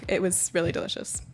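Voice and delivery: normal talking voice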